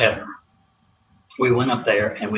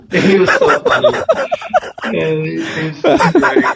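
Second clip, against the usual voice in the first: said through real laughter, higher and louder; laughing; 6 semitones higher, 4 dB louder than usual. Real, not acted. Old player remembering his funny coach, laughing. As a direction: laugh fondly as you say it.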